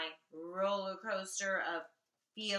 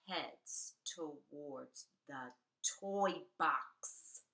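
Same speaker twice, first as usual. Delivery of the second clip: usual speaking voice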